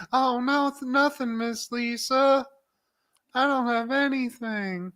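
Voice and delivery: high, soft meek voice; mock scared boy; timid backpedal. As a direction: shrink from the scolding. Darnell cowering before Lisa.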